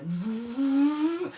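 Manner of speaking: rising vroom; voiced engine noise sweeping upward; imitated take-off whoosh. Her body taking off in the story, imitated vroom.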